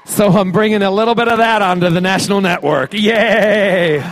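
loud, high, cheering; excited cheer. Real, not acted. Promoting his show to convention fans, loud excited cheer. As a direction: whoop it up for the crowd.

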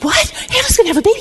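very high, astonished mock outburst; excited disbelief. Husband hears his wife will conceive, acted excited disbelief. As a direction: act out bursting with happy surprise, pitch leaping. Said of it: "Oh, he couldn't wait".

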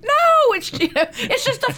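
high, excited mock protest; mock British soldier. The British scoffing at the laundry cover, mock high protest. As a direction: play the British brushing it off, high and loud. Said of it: "They were like".

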